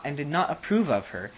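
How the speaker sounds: ordinary delivery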